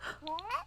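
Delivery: high acted gasp; sharp intake of mock shock. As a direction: gasp as the overhearing child. Voices child hearing parents fight.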